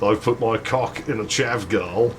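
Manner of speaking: usual speaking voice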